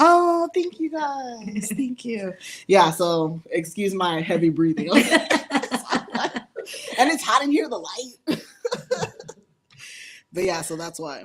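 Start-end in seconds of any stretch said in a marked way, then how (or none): 0.0-2.3 high, slow, touched thank you; delighted thanks. Real, not acted. Thanking the chat for congratulations, high and delighted. 4.9-9.2 laughing excuse. Real, not acted. Laughing about heavy breathing.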